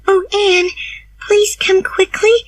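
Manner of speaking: put-on high, urgent girlish plea. A narrator's reading. Diana bursting in for help, high urgent plea. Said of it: "she said".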